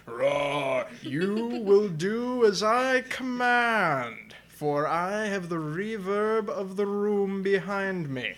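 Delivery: slow, booming monster voice; playful monster growl. Acted. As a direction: growl it out like a grand overlord. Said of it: "I'm loving the reverb I'm getting here".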